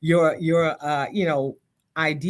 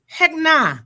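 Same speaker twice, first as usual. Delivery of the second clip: high, loud New York refusal; emphatic mock New York voice; 12 semitones higher, 7 dB louder than usual. Shown as a demonstration. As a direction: put on a punchy New York accent. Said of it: "put on my New York voice".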